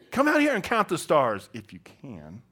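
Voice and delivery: loud invitation then muttered "if you can"; dry aside trailing to a mutter; sarcastic, under breath. Retold or imitated. God to Abram, sarcastic aside muttered under breath. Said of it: "like, under his breath".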